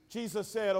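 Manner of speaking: usual speaking voice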